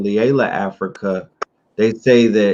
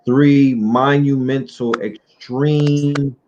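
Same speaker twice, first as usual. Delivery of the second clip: "monumental, extreme" slow and raised in pitch; emphatic stress; 4 semitones higher, slower than usual. Real, not acted. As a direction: draw out "extreme" with real weight. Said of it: "I cannot stress the word extremely".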